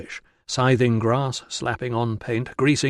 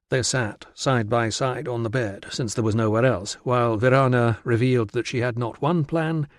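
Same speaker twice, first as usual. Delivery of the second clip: ordinary delivery, nothing marked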